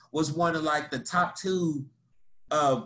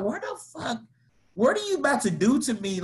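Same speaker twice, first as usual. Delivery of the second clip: higher, squeaky mock panic; 8 semitones higher than usual. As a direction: ham up being scared stiff. Panicked quote in scary drive.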